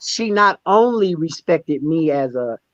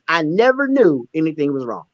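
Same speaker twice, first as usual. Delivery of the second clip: ordinary talk, nothing marked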